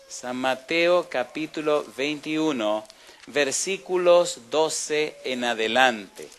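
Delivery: slow, drawn-out "San Mateo capítulo veintiuno"; slow reading. Shown as a demonstration. Preacher announcing the passage to the congregation, very slow. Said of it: "quisiera verlo despacio".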